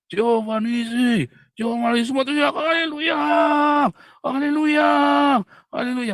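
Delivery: high, loud congregational praise yell; church shouting. Acted. Preacher imitating churchgoers shouting in praise, high loud yell. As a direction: shout hallelujah like a roused worship crowd. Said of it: "Kan teriak-teriak kan".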